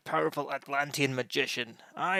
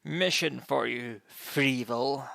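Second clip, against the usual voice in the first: usual speaking voice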